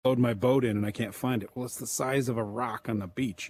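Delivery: ordinary talk, nothing marked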